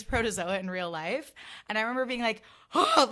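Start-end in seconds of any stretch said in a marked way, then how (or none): 2.7-3.1 sharp high gasp, much louder; starstruck gasp. Retold or imitated. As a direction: gasp like you just saw your idol. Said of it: "like, freaking out".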